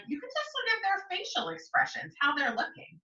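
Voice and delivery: plain reading voice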